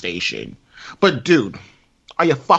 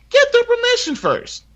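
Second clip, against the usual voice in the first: high exasperated plea. Real, not acted.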